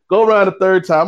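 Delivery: usual speaking voice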